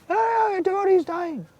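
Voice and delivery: high-pitched mocking wail; mock objector. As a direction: mimic a silly, alarmed doubter in falsetto. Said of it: "Now if somebody argues".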